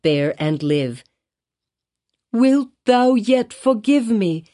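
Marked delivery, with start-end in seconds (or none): 2.3-4.4 high, slow, pleading question; repeated plea. A narrator's reading. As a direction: beg again and again for pardon. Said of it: "she repeated over and over".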